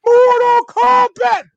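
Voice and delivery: high, drawn-out loud Mortal Kombat shout; battle-cry yell. Retold or imitated. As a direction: bellow it like a game's fight cry. Yells game's catchphrase during kick.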